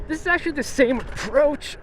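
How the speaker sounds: words shaken by a sudden bump; on a bumpy road. Real, not acted. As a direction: voice jolted by a pothole. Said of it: "Oh, that was a huge pothole".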